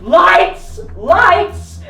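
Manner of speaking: high, loud warning cry; mock panicked warning; alarmed shout. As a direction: yell the alarm to run. Friend warns them of porch light.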